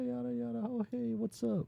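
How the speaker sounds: high-pitched put-on girl greeting; main girl voice. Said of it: "the main girl's like".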